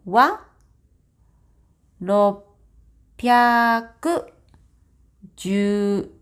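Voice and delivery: slow, clear Japanese model answer read word by word; careful model reading. Shown as a demonstration. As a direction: read it out like a textbook audio, each word separate. Reads answer slowly in Japanese.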